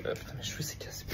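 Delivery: whispered grumble, almost unvoiced; muttered under breath. Real, not acted. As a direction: mumble it to yourself. Muttered aside about hair. (marmonné dans sa barbe)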